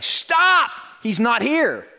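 shrieked halt, easing a little; high, loud mock-dramatic outcry; acted shout. Singer telling studio guy the Spirit is missing, high acted shout. Retells singer halting the recording.

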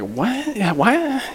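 high, soft puzzled what; mock bewildered audience; confused surprise.

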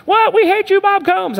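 high, loud cry of mock outrage from the pews; mock outraged congregation. Preacher voices congregation yelling at Bob, mock outrage. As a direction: play the upset churchgoers yelling at their pastor. Congregation angry at pastor's change.

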